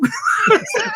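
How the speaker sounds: a squealing, very high burst of laughter; high shrieking laugh. Real, not acted. Laughing at being recognised as the guy who can't pronounce names, high shriek. Laughs at his own story.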